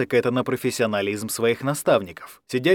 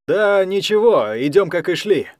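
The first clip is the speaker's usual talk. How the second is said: slow calm reply; 6 semitones higher, 5 dB louder than usual. Acted. Алекс answers slowly.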